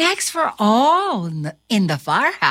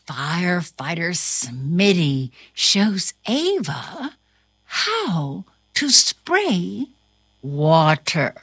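ordinary delivery

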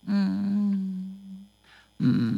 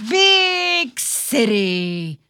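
read aloud; 6 dB louder, much slower than usual. Acted. Reading the book aloud.

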